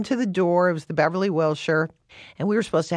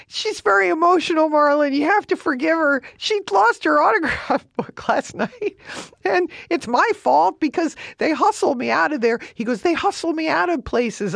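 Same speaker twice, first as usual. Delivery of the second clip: high, loud fussy apology; hammed-up husband making excuses; high mock-fretful husband; imitating Marty; 13 semitones higher, 4 dB louder than usual.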